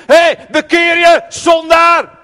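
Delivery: loud, high, hammed-up fire-and-brimstone yelling; mock hellfire shout. Preacher mocking a judgmental Jesus, hammed-up shout. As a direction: bellow it like a street preacher scolding sinners. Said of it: "jij leest het alsof Jezus zegt".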